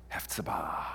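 hushed whisper of the name. Acted. Pastor whispering the congregation's new name, hushed. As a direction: breathe the name softly like a secret.

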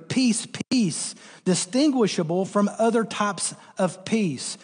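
usual speaking voice

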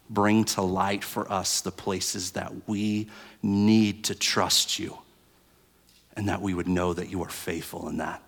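soft, hushed praying voice; prayer. Real, not acted. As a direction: pray quietly and sincerely. Pastor leads prayer.

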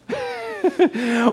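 a burst of high laughter; real laugh breaking out, high; laughing at his own story. Real, not acted. Laughs at his own retold reaction.

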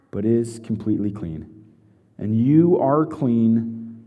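measured, solemn reading of scripture; unhurried Bible reading to the congregation. Acted. Reads scripture aloud.

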